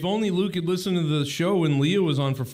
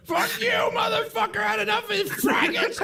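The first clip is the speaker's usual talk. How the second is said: imitated furious shout. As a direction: ham up his meltdown in a strangled yell. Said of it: "and he goes".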